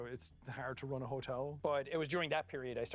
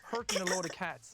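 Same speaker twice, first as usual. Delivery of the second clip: thick Irish accent; 5 semitones higher than usual. Shown as a demonstration. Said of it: "What was your accent like before?".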